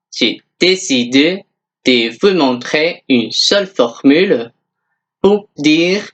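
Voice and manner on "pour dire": ordinary delivery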